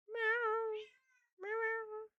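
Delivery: high, soft mock meowing at the cat; high soft meows. Streamer meowing softly back at her cat. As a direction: meow to the cat in a tiny voice.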